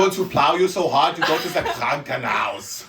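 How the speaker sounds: put-on German accent. Joking about German dirty talk, loud mock German accent. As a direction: hammer it out in a hammed-up German accent.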